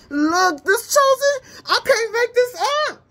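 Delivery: high, loud, amazed squeal; real giddy excitement; thrilled disbelief. Real, not acted. Cracking up at the cards.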